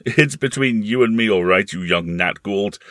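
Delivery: loud mocking words through laughter; laughing. Uncle echoing the boy's solemn words, laughing. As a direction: repeat it teasingly while laughing.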